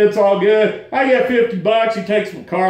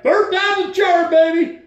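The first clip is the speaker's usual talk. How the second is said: much higher, cocky pep talk to the car; cocky hype; 8 semitones higher than usual. Retold or imitated. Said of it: "So I'm like".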